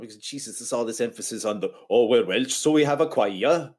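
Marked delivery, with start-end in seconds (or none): 1.9-3.7 lilting mock-Welsh that slips into Indian; botched Welsh accent. Mocking the film's Welsh choir, Indian-sounding Welsh accent. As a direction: exaggerate a bad Welsh accent, slowing at the end. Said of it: "that was my Indian accent".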